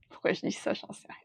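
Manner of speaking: laugh, then fade almost to nothing; amused quiet aside. Real, not acted. Reader puzzled by own remark, amused murmur to self. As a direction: say it under your breath after a little laugh.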